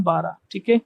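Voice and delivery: ordinary delivery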